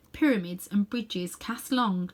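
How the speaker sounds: ordinary delivery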